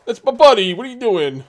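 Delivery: loud, high New York Italian protest; hammed-up New York Italian wolf defending his buddy; New York Italian accent. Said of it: "speaking like, uh, New York Italian".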